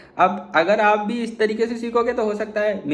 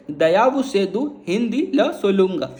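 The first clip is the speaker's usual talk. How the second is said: slow Tamil demo. Shown as a demonstration. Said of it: "तो कैसे बोलेंगे?".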